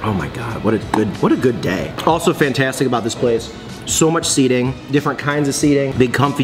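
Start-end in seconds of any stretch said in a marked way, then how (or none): none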